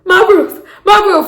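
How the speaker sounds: high-pitched imitation of a distraught man; hammed-up high wail; mock movie lament. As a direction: whine it like a comic film character. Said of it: "he was like".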